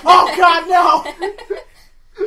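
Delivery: loud, high cry of alarm; mock horror. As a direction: yell out as the singing fish under attack. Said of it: "when somebody throws a hammer at it".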